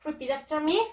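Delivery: ordinary talk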